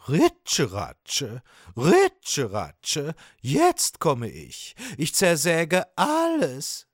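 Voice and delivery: drawn-out sawing words, then rising in pitch; slow, showy fish character voice; boastful sawfish. Acted. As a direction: announce yourself like a swaggering sawfish.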